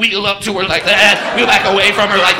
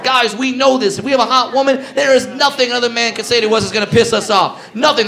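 normal talking voice

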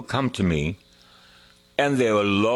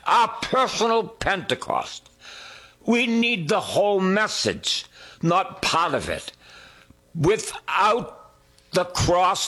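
higher, building preacher's cry; rising preaching; 5 semitones higher than usual. Real, not acted. Preacher driving the message home to the congregation, climbing. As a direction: build the climax, climbing each line.

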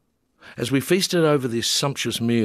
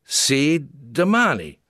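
voiced Italian man; 5 semitones higher, 4 dB louder, slower than usual. A narrator's reading. Gianni voiced repeating word.

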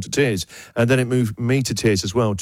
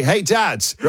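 mock announcement to dads; 6 semitones higher than usual. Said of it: "I should just say".

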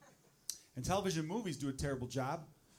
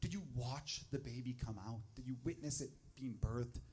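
hushed, slow, softer prying question; curious people asking; 5 semitones lower, 9 dB quieter than usual. Retold or imitated. People asking Joe about the birth, hushed curious question. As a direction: lean in and ask it quietly, like a nosy friend. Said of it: "they go".

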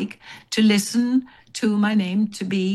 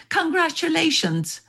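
mock shout; 9 semitones higher than usual. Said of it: "she shouted".

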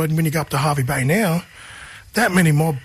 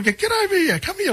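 mock calling out. Calling the kids over.

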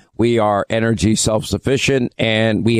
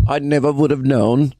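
mock disbelief; 3 semitones higher than usual. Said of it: "did you hear a British accent".

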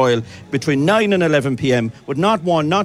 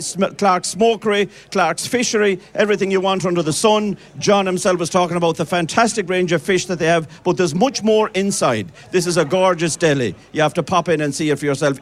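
normal talking voice

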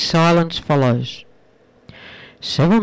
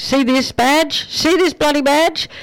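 pompous officer, rising higher; arrogant outburst; 8 semitones higher than usual. Acted. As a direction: shove the badge in his face with your voice. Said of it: "The D E A officer verbally exploded".

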